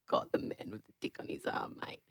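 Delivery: hushed unvoiced whisper; whispered aside. Real, not acted.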